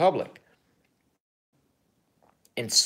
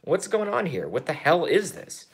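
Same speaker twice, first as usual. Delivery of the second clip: bewildered; 7 semitones higher than usual. Retold or imitated. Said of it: "I go".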